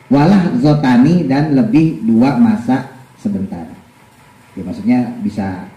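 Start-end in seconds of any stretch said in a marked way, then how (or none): 0.1-3.7 slow, measured kitab recitation; deliberate teacher's text reading; reading a religious text aloud. Real, not acted. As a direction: read the Arabic and gloss it slowly. Reads and translates the text.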